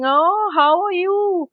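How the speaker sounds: high, slow, sugary false greeting; fake sweet greeting. Pupil greeting a hated person with false sweetness. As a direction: sound falsely friendly to someone you hate. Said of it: "but you'll be talking".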